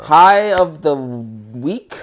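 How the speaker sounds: loud, very slow segment title; loud, long-held announcer-style title; drawn-out announcement. Real, not acted. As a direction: stretch every word out loudly, especially the last. Host announcing his segment answer.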